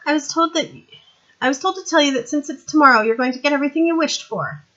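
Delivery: put-on young woman's voice. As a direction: play her bright and eager.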